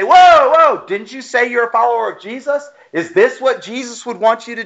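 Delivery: high, loud "whoa" that eases into a pointed question; startled rebuke. Pretend. A preacher mocks a false believer's rebuke, startled and loud. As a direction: pull them up short as if caught in the act.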